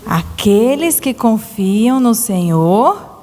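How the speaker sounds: put-on slow, clear line for children to echo; slow verse for repeating. Shown as a demonstration. Teacher leading children in a verse, slow and clear. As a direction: spell it out slowly so the kids can repeat. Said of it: "Vamos repetir comigo?".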